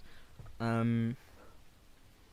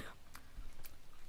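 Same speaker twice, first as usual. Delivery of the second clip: teary laugh; 12 dB quieter than usual. Real, not acted. Teary reaction to chat.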